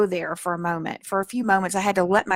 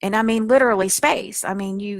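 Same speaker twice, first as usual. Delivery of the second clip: usual speaking voice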